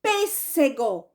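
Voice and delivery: "Pêssego" slowly, PÊS stretched out; slow teaching demo of "Pêssego", leaning on PÊS; stress on first syllable. Shown as a demonstration. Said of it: "Qual é a sílaba mais forte?".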